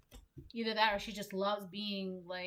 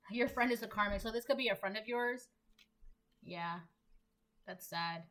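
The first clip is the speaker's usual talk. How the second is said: usual speaking voice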